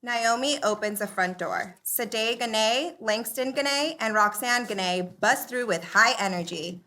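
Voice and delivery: ordinary talk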